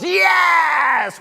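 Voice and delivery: high excited yes; excited shout. Real, not acted. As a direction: call out the win. Auctioneer calling sale.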